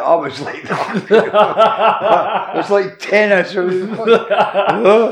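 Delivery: loud, high, helpless laughter while talking; real laughing fit, pitch shooting up; laughing hard. Real, not acted. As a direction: crack up mid-story, words squeezed through laughs. Cracks up telling tennis-like match.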